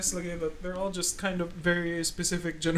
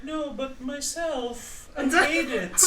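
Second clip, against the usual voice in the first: slow, higher mock-dramatic self-loathing; mock Tiny; 7 semitones higher, much slower than usual. Cohost imitates Tiny refusing, in hammed-up gloom. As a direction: ham up the brooding poet. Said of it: "It's like".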